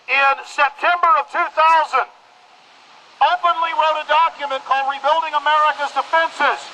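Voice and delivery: rally the crowd through a megaphone, full force; fiery street-corner sermon through a bullhorn; megaphone protest speech. Real, not acted.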